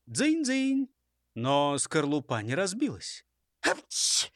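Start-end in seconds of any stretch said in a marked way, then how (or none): 0.1-0.9 high imitated clinking; ringing clink. A narrator's reading. Robbers tap spoons on eggs, high clinking. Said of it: "раздалось". 3.6-4.3 sudden unvoiced stage sneeze; acted sneeze. A narrator's reading. Robber sneezes over a strange egg, acted sneeze. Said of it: "чихнув".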